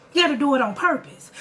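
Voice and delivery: usual speaking voice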